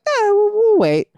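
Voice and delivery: high, put-on voice of the stubborn parents; mock stubborn parents. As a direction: squeak it out as the calmly stubborn parents. Parents' stubborn reply acted out.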